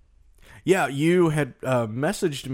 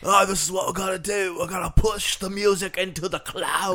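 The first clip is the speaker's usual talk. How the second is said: lightly voiced rock star, half-sung and higher; 7 semitones higher than usual. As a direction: ham up a grand visionary rock star announcing his plan. Mock [name] pitching the cloud.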